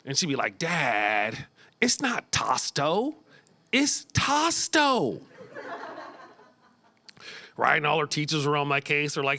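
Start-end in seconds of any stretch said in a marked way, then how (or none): 0.6-5.2 put-on toddler voice. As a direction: drag it out like a stubborn three-year-old. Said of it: "problems with her C's and her T's".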